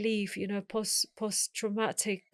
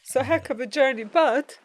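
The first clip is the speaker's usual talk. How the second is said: high, louder voice cracking with real emotion; apologising while trying to hold back tears; fighting tears; 4 semitones higher, 9 dB louder than usual. Real, not acted. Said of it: "sorry guys, I'm gonna pull myself together".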